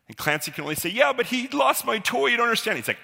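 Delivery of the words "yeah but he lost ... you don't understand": much higher, pleading excuse; a cornered kid whining his side; whiny caught bully. Retold or imitated.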